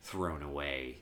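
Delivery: low, subdued retelling of a bitter reply; quiet retold bitterness. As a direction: act out a wounded man, soft and low. Acting out bitter Harvey Dent.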